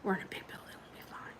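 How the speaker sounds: very soft whisper; barely audible, under the breath; hushed self-reassurance. Real, not acted. Reassuring self quietly after nervousness.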